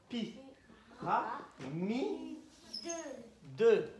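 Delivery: "Pi", "Ra", "Mi", "Deux" with clear gaps between; syllable by syllable. Shown as a demonstration. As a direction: sound out each syllable separately for listening. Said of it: "écoute bien". (« Pi. Ra. Mi. Deux » syllabe par syllabe)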